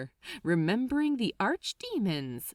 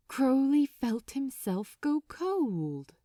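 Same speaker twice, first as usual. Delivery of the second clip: ordinary delivery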